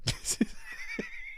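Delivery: squealing giggle at a friend's antics; high squealing laugh. Real, not acted. Reacting to a friend in chat.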